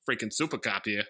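put-on low Boston-accent movie quote. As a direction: imitate an actor's sudden Boston accent. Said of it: "all of a sudden has a Boston accent".

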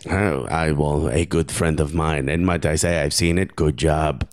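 low mock French-Argentinian accent; comic low-pitched accent, vaguely French; Karim Benzema. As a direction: explain it in a put-on, muddled foreign accent. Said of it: "I promise this is Karim Benzema".

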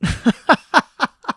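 high, loud burst of laughter; string of high, loud laughs; real laugh. Real, not acted. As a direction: crack up at your own admission. Laughs at own living situation.

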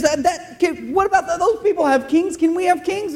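put-on high, whining childish voice; whiny child. Israel asking God for kings, high whiny child. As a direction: beg like a kid pointing at other kids' toys. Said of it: "That's how they sounded".

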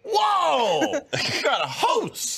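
high, laughing amazed whoa; excited. Retold or imitated. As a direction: squeal with delight. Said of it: "I went".

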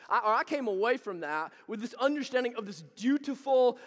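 ordinary talk

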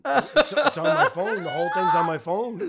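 high laughing protest. Real, not acted. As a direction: laugh through the words. Dad laughing, flustered.

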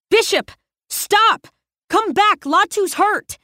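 calling out. Acted. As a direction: shout after him down the hall. Calling after Bishop.

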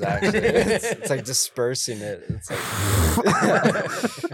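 laughing. Real, not acted. Laughing about mic noise.

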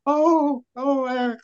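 high, cooing women greeting a favourite; fawning women. Retold or imitated. Fans cooing high over Ack as he walks in. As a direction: coo his name up high like a fan.

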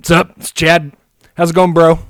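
loud, pumped frat-bro voice; full Chad bro. Pretend. Calling the Wolves GM as a loud pumped bro. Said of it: "we're going full Chad".

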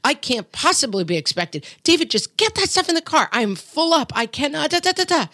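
hammed-up exhausted mom venting; frazzled mom. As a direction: play the frazzled mom who is done. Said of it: "I think this is a very mom thing".

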